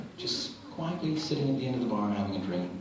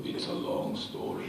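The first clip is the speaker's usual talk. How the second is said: Andre voice; 11 semitones lower, 7 dB quieter than usual. Retold or imitated.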